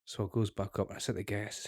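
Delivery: ordinary talk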